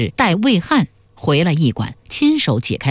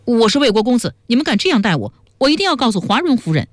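indignant mock yell; 6 semitones higher, faster than usual. A narrator's reading. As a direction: cry out high as an outraged captive prince, without real volume. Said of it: "他便连连地喊道".

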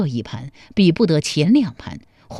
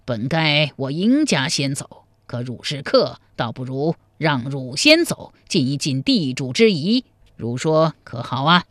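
put-on lower, courteous old host; gracious old man; 4 semitones lower than usual. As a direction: lightly play an old man offering the first move. Narrator voices the old chess player. (老者腔，低沉客气地相让)

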